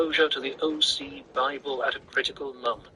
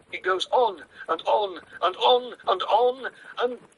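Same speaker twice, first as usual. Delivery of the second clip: edge of hysteria; 9 semitones higher than usual. A narrator's reading. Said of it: "hysteria edging his voice".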